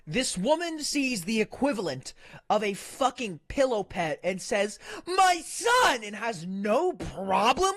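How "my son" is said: much higher, louder hammed-up cry; mock mother's wail. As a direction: overplay the mother's shriek.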